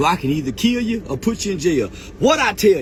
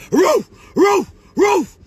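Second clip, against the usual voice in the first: much higher, louder shouted woofs; mock dog bark; 10 semitones higher, 8 dB louder, much slower than usual. Mimicking how Woody thinks he sounds, loud mock barks. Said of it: "You think you sound like this".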